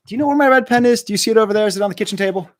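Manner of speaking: loud, high call to someone in another room. Real, not acted. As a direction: shout across the house for the lost pen. Calling to someone in the house.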